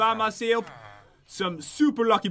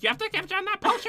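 high, fast witchy voice; witch voice; 11 semitones higher, faster than usual. Retold or imitated. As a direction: cackle it like a witch. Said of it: "I sound like a witch".

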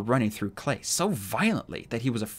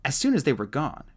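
ordinary delivery, nothing marked

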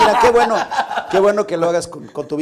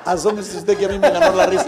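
laughing retelling; 4 semitones higher, 9 dB louder than usual. Retold or imitated. Retold punchline.

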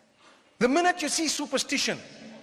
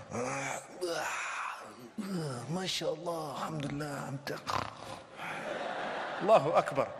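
mock overstuffed; 7 semitones lower, 9 dB quieter than usual. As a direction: act too full to talk, trailing off. Acting out someone stuffed from overeating.

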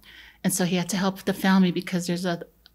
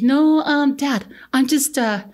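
protesting; 4 semitones higher, 4 dB louder than usual. Retold or imitated. Son defending himself to angry dad.

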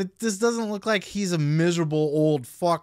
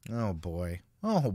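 ordinary talk

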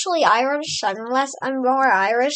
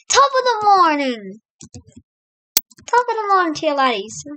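higher-pitched mock Irish greeting; Irish accent; 5 semitones higher than usual. Shown as a demonstration. Player showing viewers an example, Irish accent. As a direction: put on a cheery Irish brogue. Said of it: "I can also speak in an Irish accent".

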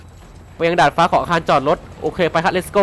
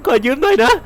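fake-sob the apology with a laugh; mock crying; 4 semitones higher than usual. Player mock-sobbing as he takes the dying friend's gun. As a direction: whimper it jokingly, pitch up.

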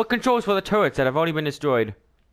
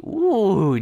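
ordinary talk

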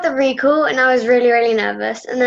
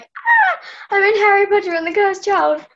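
a high squeal on ah, then a bright, thrilled rush; excited realisation; 7 semitones higher than usual. Retold or imitated. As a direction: relive the moment it finally sank in. Retelling her moment of realisation.

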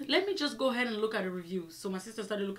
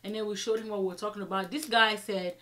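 ordinary talk

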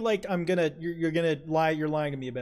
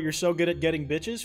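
ordinary talk

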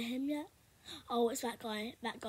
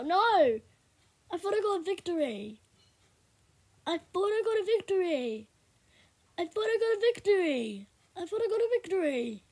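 louder, higher, repeated in disbelief; dismayed cry; 6 semitones higher, 9 dB louder than usual. Real, not acted. Player who lost the round crying out, louder and higher. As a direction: wail it over and over, robbed of the win.

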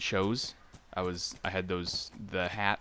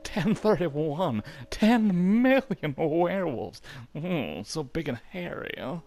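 hammed-up flirty guard, slow and higher; teasing soldier voice; 7 semitones higher, 4 dB louder than usual. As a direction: drag out the joke like a cheeky sentry. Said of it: "concentrating on not forgetting how to do that voice".

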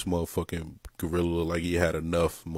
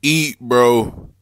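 eat bro yelled loud and high; shouted urging; 6 semitones higher, 10 dB louder than usual. Real, not acted. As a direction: shout it like cheering him on.

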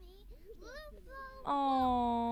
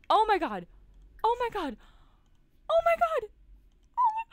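loud, high, pleading denial that builds; rising to a near-scream of oh my god; real desperate panic; 7 semitones higher, 8 dB louder than usual. Real, not acted. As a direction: beg the screen not to let her die. Pleading as her Sim dies.

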